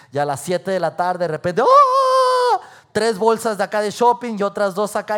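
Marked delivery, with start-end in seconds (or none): 1.6-2.6 long, very high, loud shriek; acted squeal. Shopper giving in by seven in the evening, squealing. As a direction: let out a drawn-out mock scream.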